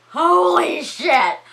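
gasp, then drawn-out loud cry; high, slow burst of shock; stunned exclamation. Real, not acted. Storyteller reacts to the film's climax, stunned and loud.

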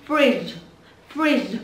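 "Fridge" said quickly as one blended word; nói nhanh. Shown as a demonstration. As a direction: snap the sounds of "Fridge" together. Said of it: "Nói nhanh". (đọc "Fridge" nhanh, ghép liền các âm)

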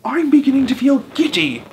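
very high, loud cries for help; hysterical screaming. A narrator's reading. Missus Twit screams for help in panic, very high and loud. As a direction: shriek in mounting panic. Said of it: "screamed Missus Twit".

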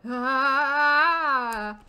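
loud drawn-out "aah"; long, loud yell; scream. Real, not acted. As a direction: cry out loudly and hold it. Startled on waking in game.

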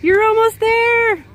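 loud encouraging shout across the bridge; calling out. Real, not acted. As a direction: cheer them on from afar.

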